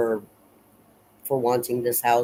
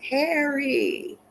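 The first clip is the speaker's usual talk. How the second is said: put-on high, far-carrying call of a little girl calling her dog; child calling out. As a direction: call the dog's name from afar like a little girl. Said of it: "Amy says".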